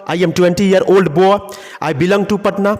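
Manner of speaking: slowed, careful phrasing; slow model sentence. Shown as a demonstration. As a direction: spell it out slowly for the class. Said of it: "देखिए, फिर गौर कीजिए".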